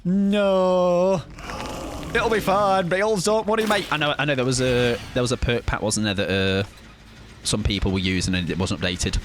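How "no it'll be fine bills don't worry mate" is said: cocky mock reassurance. Mock carefree reply to chat.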